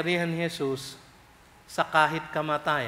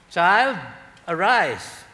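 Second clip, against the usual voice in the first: commanding; 4 dB louder, much slower than usual. Retold or imitated. Jesus raising the dead.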